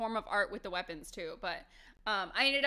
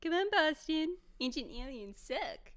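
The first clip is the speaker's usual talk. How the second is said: dog voice; 5 semitones higher than usual. Acted. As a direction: silly voice for the dog. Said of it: "We do different voices for Boston and Falcon".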